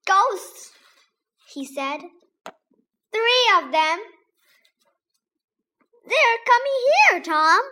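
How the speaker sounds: loud, high frightened cry; panicked boy. A narrator's reading. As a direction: shout it in terror.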